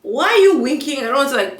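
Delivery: incredulous retort. Retold or imitated. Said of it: "I'm like".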